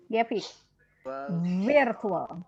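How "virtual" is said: "Virtual" with a long, heavy v at the start; thickened v. Shown as a demonstration. Teacher asking student to thicken it, heavy v. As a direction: lean on the v of "Virtual". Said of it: "Wahnya boleh nggak ditebelin".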